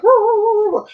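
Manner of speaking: high yapping barks; hammed-up little dog barking. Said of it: "he started telling her".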